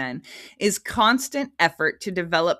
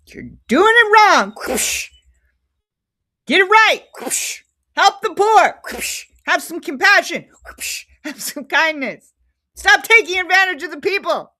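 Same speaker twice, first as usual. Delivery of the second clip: high, loud righteous scolding between lashes; mock Jesus scolding; 11 semitones higher, 8 dB louder than usual. Jesus driving out the temple crowd, high loud scolding. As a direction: bellow it like Jesus cracking the whip.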